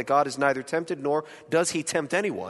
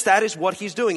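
ordinary talk

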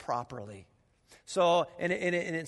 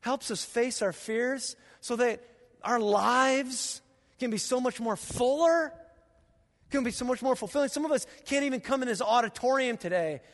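usual speaking voice

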